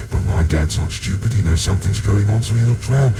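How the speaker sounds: plain reading voice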